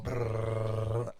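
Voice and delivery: rolled-tongue drumroll noise; drum roll. Pretend. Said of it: "Drum roll".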